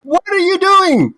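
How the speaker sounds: very high, outraged yelling; alarmed shriek. Acted. Screaming match with kobolds.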